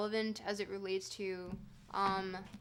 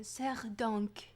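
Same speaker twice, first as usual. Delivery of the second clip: put-on French accent. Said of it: "say it in a French accent".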